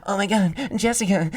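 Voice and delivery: high, loud melodrama; overacted movie line. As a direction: beg like a soap opera hero. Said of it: "you're watching a movie, it's like".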